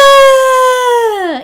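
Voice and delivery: high, loud crying wail; mock wail. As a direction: let out a sobbing wail. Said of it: "Even more".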